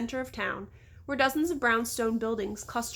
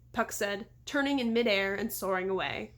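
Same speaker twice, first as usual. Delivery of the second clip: plain reading voice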